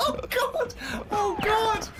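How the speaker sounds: laughing. Real, not acted. Laughing at humiliating story.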